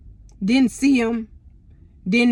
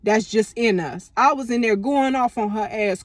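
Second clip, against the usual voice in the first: ordinary talk, nothing marked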